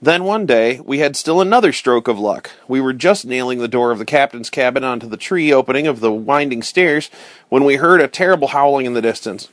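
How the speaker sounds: plain reading voice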